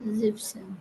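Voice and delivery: जिप सेन barely voiced, under the breath; soft whispered repeat. Real, not acted. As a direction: whisper the word back to yourself. Student repeats word softly. (धीमी फुसफुसाहट में दोहराना)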